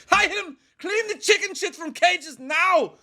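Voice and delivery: very high, loud mock Schwarzenegger yell; bad Arnold accent. Mother ordering cages cleaned, bad Arnold-accent yell. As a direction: bellow slowly in a terrible Arnold accent. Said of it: "Why am I Arnold?".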